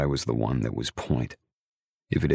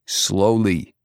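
higher, louder word of caution; 6 semitones higher, 6 dB louder than usual. A narrator's reading. Soldier slowing a thirsty woman's gulping, firm caution. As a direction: caution them firmly in a raised voice.

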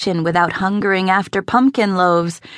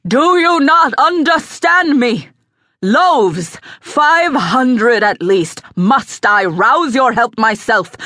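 raised, forceful furious tirade; angry roar; 4 semitones higher, 3 dB louder than usual. A narrator's reading. Aldermaston berating his cook over the loaves, roaring in anger. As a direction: thunder each demand with heavy emphasis. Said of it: "the aldermaston roared".

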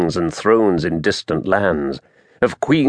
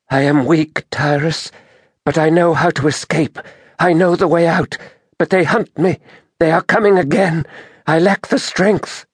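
high, weak woman's voice; Merinda; 9 semitones higher than usual. A narrator's reading. Injured druid tells Taras she is hunted, weakly pleading. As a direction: plead feebly in a high, strained woman's pitch.

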